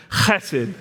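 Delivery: chesed with a throat-clearing ch; guttural chesed. Shown as a demonstration. Pastor leading the congregation in saying it, guttural. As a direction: rasp the ch in chesed. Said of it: "Say it one more time".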